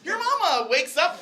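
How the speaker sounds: ordinary talk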